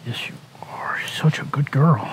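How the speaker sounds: whispered praise for a pet; whispering to a dog. Real, not acted.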